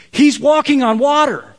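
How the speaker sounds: high, loud, drawn-out exclamation; emphatic shout. Real, not acted. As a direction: shout it as the point everyone is missing. Exasperated rebuttal to those calling failure.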